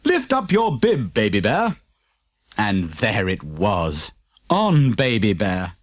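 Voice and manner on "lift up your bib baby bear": higher, lightly voiced jolly postman; a cheery knowing chuckle; chuckling postman. A narrator's reading. Postman speaks.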